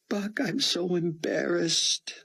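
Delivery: slow, high tearful moan. A narrator's reading. Chloe moans her shame to Buck, slow and tearful. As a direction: let the shame pull the words out slowly. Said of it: "she moaned".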